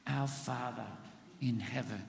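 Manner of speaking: congregation repeat, hushed; a soft repeat with the church; softly repeated. Shown as a demonstration. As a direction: say it back softly with them. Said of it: "Say that with me".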